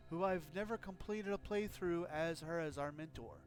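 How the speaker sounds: usual speaking voice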